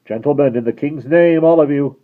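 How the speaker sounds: loud, high urgent command; guard commanding the passengers; hurried adjuration. Acted. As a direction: order them in the king's name. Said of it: "With this hurried adjuration".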